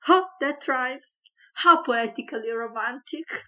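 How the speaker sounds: high, loud, gushing delight. A narrator's reading. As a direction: squeal with romantic delight. Merop gushes with delight.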